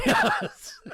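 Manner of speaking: loud, high burst of laughter; laughing. Real, not acted. Host echoing co-host's autograph joke, really laughing. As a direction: crack up while echoing it.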